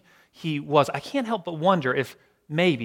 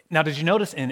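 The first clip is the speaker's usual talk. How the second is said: ordinary talk, nothing marked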